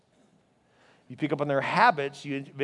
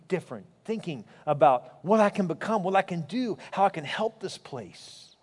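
prayer; 3 semitones lower than usual. Acted. Leads the congregation in prayer.

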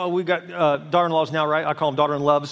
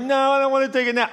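higher-pitched whiny refusal; protesting little kid; 7 semitones higher than usual. Acted. Little kid told to take a nap, whiny protest. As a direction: imitate a child fighting naptime. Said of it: "you ever notice little, little kids".

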